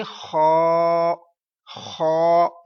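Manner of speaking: खौ drawn out slow in a full heavy voice; teacher modelling a heavy खौ; heavy khau. Shown as a demonstration. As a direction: say खौ thick and long. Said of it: "मोटी आवाज में".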